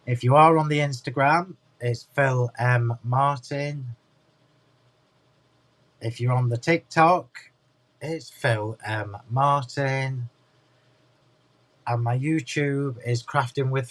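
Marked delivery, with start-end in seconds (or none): 1.8-3.9 slow. Real, not acted. Spelling out social handle. 8.0-10.3 slow. Real, not acted. Spelling out social handle.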